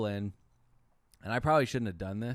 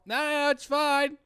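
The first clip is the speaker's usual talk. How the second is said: high, loud insistent no; loud repeated no at the window; firm refusal; 10 dB louder than usual. Retold or imitated. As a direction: wave her off loudly. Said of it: "I go".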